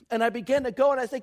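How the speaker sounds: normal talking voice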